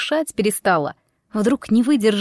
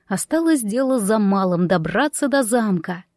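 ordinary delivery, nothing marked

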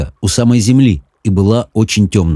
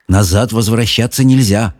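plain reading voice

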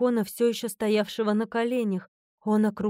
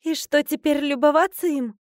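higher, louder mild grumble; grumbling; 6 semitones higher, 5 dB louder than usual. A narrator's reading. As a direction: object with a touch of grumbling. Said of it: "проворчала я". (слегка ворчливо, повысив голос)

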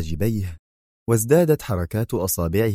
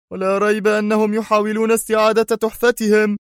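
much higher, agitated voice; frantic, he fears the Egyptians; nervous shout; 13 semitones higher, 3 dB louder than usual. Acted. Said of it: "صاح فان ديك في عصبية".

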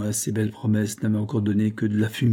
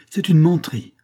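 higher, louder indignant denial; trembling denial; 7 semitones higher, 6 dB louder than usual. A narrator's reading. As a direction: fling the denial back. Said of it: "toute frémissante".

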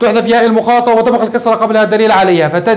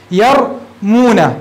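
drawn out slowly; much slower than usual. Shown as a demonstration. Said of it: "teacher models the form".

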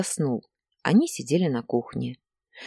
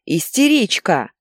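slow, loud insult, higher; yelling; 5 semitones higher, 6 dB louder, slower than usual. A narrator's reading. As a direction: hurl the word out, stretched. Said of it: "орал Игорь". (протяжный злой выкрик)

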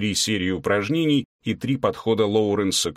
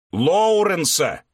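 slow, higher, louder shout from the kitchen; shouted correction; 8 semitones higher, 4 dB louder, much slower than usual. A narrator's reading. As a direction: call out the full name, indignant. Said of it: "донеслось с кухни". (громкая поправка из кухни)

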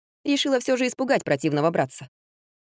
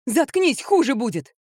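higher-pitched acted yell; 6 semitones higher, slower than usual. A narrator's reading. Daughter threatens her ranting mother, yelling in a higher voice. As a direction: shut them up with a raised, warning cry. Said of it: "заорала Мисси".

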